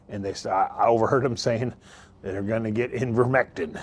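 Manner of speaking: ordinary talk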